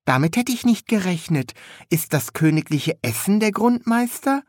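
high, curious apprentice voice; high-pitched inquisitive apprentice; young apprentice, curious. A narrator's reading. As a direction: ask it high, full of naive wonder.

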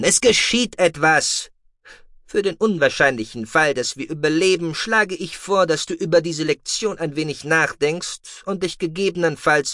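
a calm officer scolding his deputy; stern commander. Acted.

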